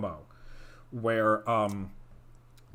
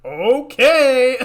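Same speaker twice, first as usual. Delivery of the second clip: sarcastic okay; 10 semitones higher, 8 dB louder than usual. Retold or imitated. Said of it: "And I'm like".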